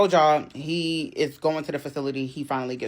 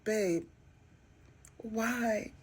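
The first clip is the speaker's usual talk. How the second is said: mock distraught; 6 semitones higher, 9 dB quieter than usual. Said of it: "And I'm just like".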